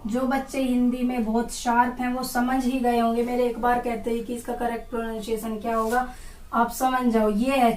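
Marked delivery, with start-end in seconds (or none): none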